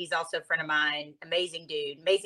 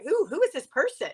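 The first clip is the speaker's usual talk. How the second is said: curious surprise; 9 semitones higher than usual. Retold or imitated. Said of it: "And I'm like".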